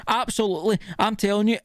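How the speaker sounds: usual speaking voice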